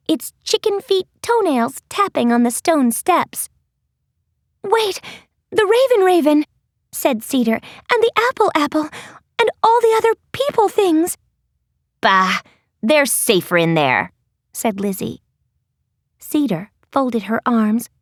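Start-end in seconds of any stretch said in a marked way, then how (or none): 4.6-11.2 put-on higher, worried young voice; anxious girl voice. Cedar's voice, worried. 12.0-14.1 low, dismissive bossy tone; bossy girl voice. Acted. Lizzie's voice, dismissive.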